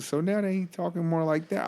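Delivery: usual speaking voice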